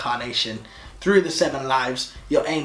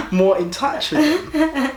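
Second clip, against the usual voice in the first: laughing while repeating; high laughing echo; 11 semitones higher, 5 dB louder, slower than usual. Real, not acted. Laughing repeat of partner's words.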